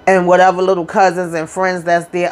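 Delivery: ordinary talk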